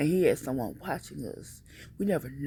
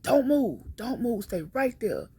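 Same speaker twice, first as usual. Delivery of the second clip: louder, higher barked order; police command; 9 semitones higher, 5 dB louder than usual. Retold or imitated. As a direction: command him to freeze. Police officers ordering the stalker.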